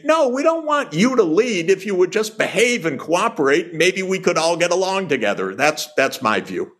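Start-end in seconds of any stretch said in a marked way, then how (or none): 0.0-5.6 voicing the world. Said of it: "we know what they say".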